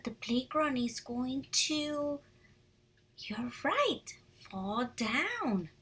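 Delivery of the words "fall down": normal talking voice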